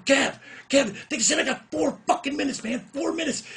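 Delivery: screamed way up high and loud; furious shout. Retold or imitated. Yelling to Kevin about the cut time, furious shout. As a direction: lose it completely at a friend.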